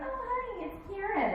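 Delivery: high, soft sugary mom; sweet mom voice. Retold or imitated. Friend's mom greeting a kid on the phone, high and sugary. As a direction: coo it like a doting mom. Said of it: "she's like".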